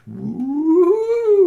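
long high loud spooky moan; eerie wailing ooh from the tree; ghostly ooh. Acted. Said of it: "and the tree".

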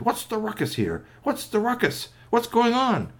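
high-pitched, rapid-fire character demand; startled questioning. Acted. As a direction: demand to know about the commotion. Uncle Key comes out at commotion.

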